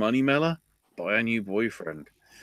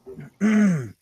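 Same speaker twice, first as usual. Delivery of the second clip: mock throat clear; 10 semitones higher, 6 dB louder than usual.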